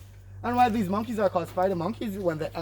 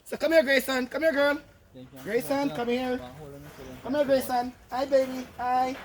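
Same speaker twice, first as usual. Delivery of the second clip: higher, soft coaxing voice; 6 semitones higher, 4 dB quieter than usual. Real, not acted. Guide coaxing baby monkey Grayson.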